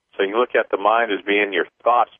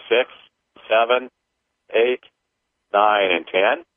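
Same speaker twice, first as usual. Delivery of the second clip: measured, higher counting voice; paced ritual counting; 5 semitones higher than usual. Acted. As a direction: count each number out deliberately. Leads a clearing count.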